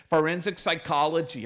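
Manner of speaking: "Forensic psychology" said quickly and flatly, falling at the end; quick deadpan answer. Retold or imitated. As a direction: snap back "Forensic psychology" matter-of-factly, no pause. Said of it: "she was very quick".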